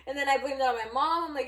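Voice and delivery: normal talking voice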